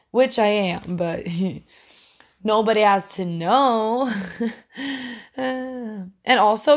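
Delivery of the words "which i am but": usual speaking voice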